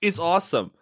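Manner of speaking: high, loud gushing "it's awesome"; overhyped mock praise; mock enthusiasm. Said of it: "Don't be like".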